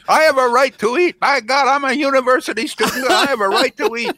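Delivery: very high, loud whiny entitled student; shrill parody of a spoiled kid; whiny mocking imitation. Host mimics whiny caller after call.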